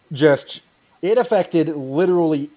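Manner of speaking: usual speaking voice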